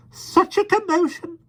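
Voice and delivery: very high, slow fussy twitter; shrill twitter. A narrator's reading. Miss Lark flusters at the commotion, very high twitter. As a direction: twitter like a flustered old lady. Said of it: "she twittered shrilly".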